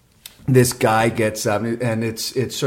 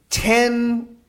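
usual speaking voice

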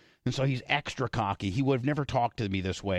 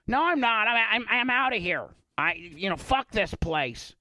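mock angry refusal; 9 semitones higher than usual. Tuttle walking out on the host, imitated high angry yell. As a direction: storm out like a sulky star. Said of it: "He's like".

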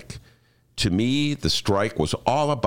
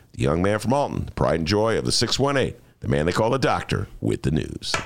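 lower, mock-grand introduction; announcer intro; 6 semitones lower than usual. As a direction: announce him like a big act.

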